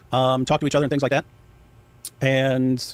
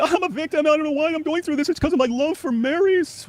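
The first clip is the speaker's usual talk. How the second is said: much higher whiny mock self-pity; victim Sam; 13 semitones higher than usual. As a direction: ham up the poor-me act. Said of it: "you get victim Sam".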